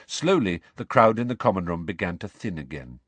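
ordinary delivery

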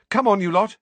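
calling out. A narrator's reading. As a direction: round up the kids. Mother calls the children.

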